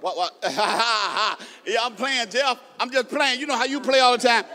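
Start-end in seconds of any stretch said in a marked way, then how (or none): 0.4-1.4 teasing chuckle; playful burst of laughter. Real, not acted. Laughs while teasing listener.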